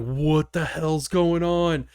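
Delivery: very slow, stretched-out words; slow motion. Retold or imitated. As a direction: mimic a slowed-down film voice. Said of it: "everything went into slow motion".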